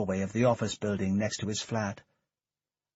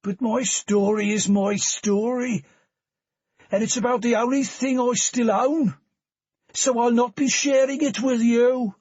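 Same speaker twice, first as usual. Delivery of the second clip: high, slurred Birmingham tramp voice; drunk Birmingham accent; 13 semitones higher, 7 dB louder than usual. Acted. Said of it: "in a Birmingham accent slurred by alcohol".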